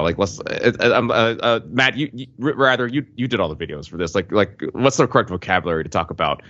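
normal talking voice

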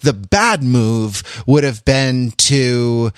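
ordinary talk, nothing marked

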